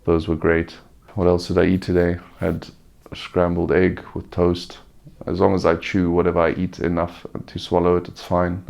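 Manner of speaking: ordinary talk, nothing marked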